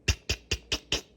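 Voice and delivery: crisp tongue clicks for knocks; tick-tick knocking noise; imitated door knocking. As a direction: click out five quick knocks.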